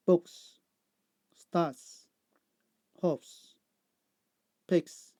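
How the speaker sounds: single words with pauses, each closing on hissed "s"; S endings. Shown as a demonstration. Teacher giving answers to learners, clear S endings. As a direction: read the list carefully, crisp final S. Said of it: "S sound".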